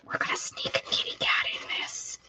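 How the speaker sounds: sneaky, conspiratorial whisper. Real, not acted. Crafter sneaking a kitty cat into the piece, whispering. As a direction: whisper it as if getting away with something.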